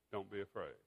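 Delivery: soft, low and tender; gentle reassurance. Retold or imitated. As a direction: let it settle quietly as comfort. Jesus's words repeated for reflection.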